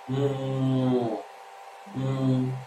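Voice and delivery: long hummed nasal sound for "मुंह"; closed-mouth nasal. Shown as a demonstration. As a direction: hum "मुंह" through the nose with mouth shut. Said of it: "मुंह बंद, मुंह से कोई जरूरत नहीं पड़ेगी".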